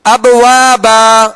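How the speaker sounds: slow ابوابا with a long final "baa"; alif-length stop. Shown as a demonstration. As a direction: stretch the closing aa of ابوابا. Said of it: "دو زبر کی تنوین کو الف سے بدل کر".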